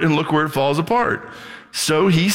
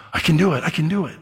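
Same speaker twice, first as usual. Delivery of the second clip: higher-pitched, gasping little discovery; amazed child; 4 semitones higher, faster than usual. Acted. Child to Daddy on finally managing it, acted amazement.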